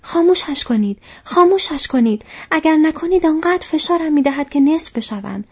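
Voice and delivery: high, loud urgent yell; loud shout. A narrator's reading. Merry trapped in the tree, shouting for the fire out. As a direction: shout it out in alarm. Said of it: "با صدای بلند فریاد زد".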